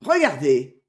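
loud, eager call; brief excited exclamation; lively exclamation. A narrator's reading. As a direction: call out to make them look. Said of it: "s'écria vivement le moine Tuck".

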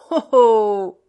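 big, drawn-out call of recognition; loud, knowing exclamation. Acted. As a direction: burst out loudly on the discovery. Médéric exclaims on finding Sidoine.

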